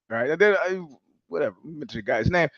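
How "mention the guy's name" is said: ordinary talk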